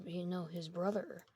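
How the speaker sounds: ordinary delivery, nothing marked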